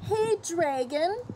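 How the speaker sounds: plain reading voice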